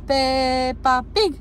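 very slow, stretched-out Peppa Pig; slow syllable-by-syllable prompt. Shown as a demonstration. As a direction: draw out each syllable for a toddler to copy. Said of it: "Ia zi lui mama".

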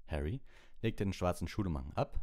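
plain reading voice

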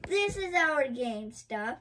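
normal talking voice

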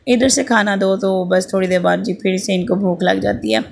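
ordinary talk

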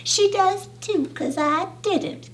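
high, soft, huffy sister; sniffy. A narrator's reading. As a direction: sniff the denial, dainty and offended. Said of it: "Kelsey Ann sniffed".

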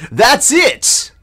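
high, bright burst of discovery; excited realization. Acted. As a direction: shout it as a sudden idea. Character's sudden realization.

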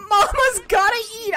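high, loud laughing echo. Real, not acted. Streamer echoing the snack-hungry Cortana, laughing. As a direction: laugh out the words.

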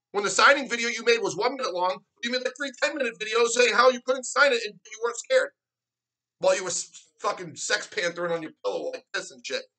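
ordinary talk

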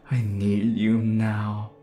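slow, sustained, insistent need; drawn-out demand. Acted. Lover craving darling, drawn-out insistent demand. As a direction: stretch each word with hungry insistence. Needy plea, intensified take.

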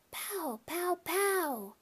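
put-on high kid voice going pow; a little boy pretending to shoot; toddler play sounds. A narrator's reading. Toddler throwing popcorn in the theater, high playful pow. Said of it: "I heard Fudge whisper".

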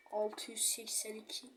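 şifreyi kendine fısıldıyor; sessizce, nefesle fısılda; fısıltı. Real, not acted. Whispering the safe code. (kodu ezberlerken fısıltıyla)

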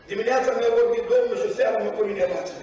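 usual speaking voice

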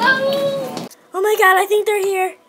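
very high, loud squeals; excited squealing. Real, not acted. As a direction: shriek with pure delight. New laptop arrives.

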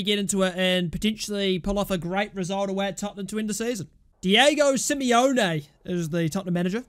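ordinary delivery

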